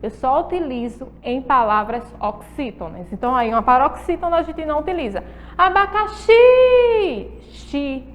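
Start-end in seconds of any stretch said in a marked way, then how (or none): 5.6-7.3 "Abacaxi" with XI held long, high and loud; exaggerated ringing XI at the end of "Abacaxi"; final syllable stressed. Shown as a demonstration. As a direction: call out "Abacaxi", stretching the last syllable. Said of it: "é a última sílaba".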